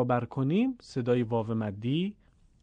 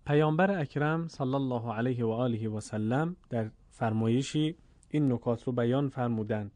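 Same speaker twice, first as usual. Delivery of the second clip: usual speaking voice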